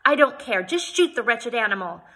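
a lady overruling the hired man; indignant insistence. Acted. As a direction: refuse to be reasoned with. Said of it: "I stamped my foot again".

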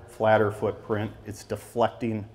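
usual speaking voice

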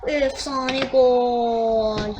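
slow, loud stretched call; long drawn-out legendary goal call; drawn-out hype. Real, not acted. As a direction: stretch it like a stadium announcer.

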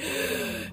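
a quick mock gasp; sharp gasp. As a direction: suck in air in alarm. Gasp before desperate plea.

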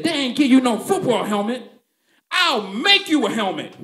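high loud proud declaration; dad declaring. Retold or imitated. Dad promising his son a helmet, high and loud. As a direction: announce it with big confidence. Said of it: "looked at me and said".

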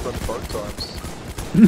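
very slow, drawn-out tease. Pretend. Friend slowly teases the gamer. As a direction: drag it out to mock. Teasing drawled line.

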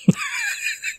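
squeaky burst of laughter; high-pitched giggle. Real, not acted. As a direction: giggle at your own joke. Laughs at own joke.

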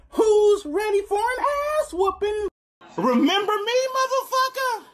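high, loud hype-man taunt; hyped high voice. Acted. Speaker greeting the viewers as a taunting villain, high and loud.

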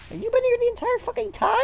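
quick cartoon squeak; fast squeaky voice. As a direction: rattle it off high and tiny.

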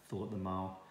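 ordinary delivery

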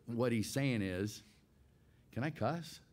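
ordinary talk, nothing marked

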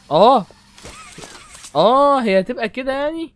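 loud high startled yelp; startled cry. Real, not acted. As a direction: caught off guard by a jump scare. Startled by game scare.

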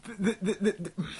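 high, stuck stammer; sputtering with frustration. Real, not acted. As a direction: stammer on the first sound, too exasperated to go on. Too enraged to speak.

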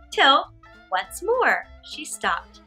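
usual speaking voice

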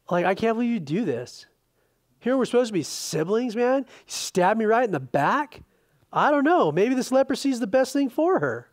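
rising indignant mock complaint, hammed up; mock wounded rant. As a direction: play the hurt brother, half joking. Preacher voices his own hurt reply.

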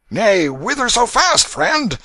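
put-on sway it like a merry drunk; tipsy waterman. A narrator's reading. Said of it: "exalted with liquor".

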